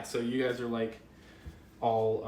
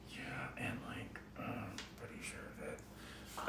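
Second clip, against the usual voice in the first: hushed whisper; 5 semitones lower, 18 dB quieter than usual. Acted. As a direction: whisper it low to himself. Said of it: "dropping his head and being like".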